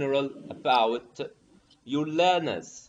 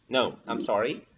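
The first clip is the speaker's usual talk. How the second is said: ordinary delivery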